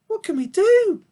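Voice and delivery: put-on very high young piglet asking. A narrator's reading. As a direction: ask anxiously as a small piglet. Babe asks.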